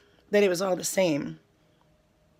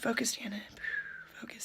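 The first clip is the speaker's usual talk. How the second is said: voiceless self-reminder; whispering to herself; 3 semitones higher, 11 dB quieter, faster than usual. Real, not acted. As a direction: hush yourself back on track. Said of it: "talks to herself".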